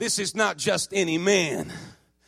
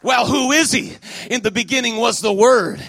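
forceful, declaiming preacher with punched key words; volle prediking; 3 semitones higher, 4 dB louder than usual. Acted. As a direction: proclaim it to the whole church.

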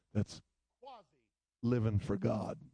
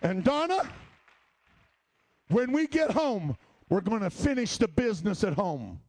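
stern mother's warning; 7 semitones higher, 4 dB louder than usual. Retold or imitated. Mother warns Donna about punishment at home, stern. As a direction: warn her what waits at home.

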